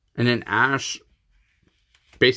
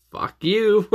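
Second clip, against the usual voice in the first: high, louder mock outburst; mock retort; 10 semitones higher, 4 dB louder than usual. Viewer mock yelling back at Misty's jab, high and loud. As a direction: fire back in a high, loud jokey snap.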